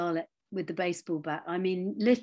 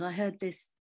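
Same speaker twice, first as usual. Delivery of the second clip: normal talking voice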